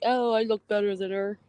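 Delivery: mock snooty mimic of a rival bragging; mocking a jealous streamer's boast. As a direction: sneer it like a jealous rival showing off. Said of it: "Say, like, jealous".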